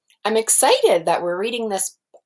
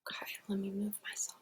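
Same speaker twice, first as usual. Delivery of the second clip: quick unvoiced whisper to herself; whispered aside; 19 dB quieter, faster than usual. Real, not acted. Teacher whispering to herself while shifting position. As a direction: breathe it out as a private aside.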